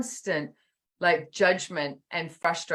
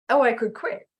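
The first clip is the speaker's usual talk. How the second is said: sudden higher, louder inner voice giving in; tempted to quit; 6 semitones higher, 5 dB louder than usual. Retold or imitated. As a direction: let the tired self offer a way out. Inner voice, workout counting game.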